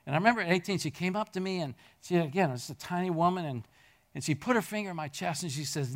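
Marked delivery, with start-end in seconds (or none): none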